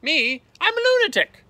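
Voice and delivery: squealed high and loud, delighted with himself; a madman proudly announcing it; gleeful lunatic. A narrator's reading. Butterfly hunter to Tifky, squealing with glee. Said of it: "said the butterfly hunter".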